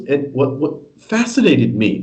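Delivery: ordinary talk, nothing marked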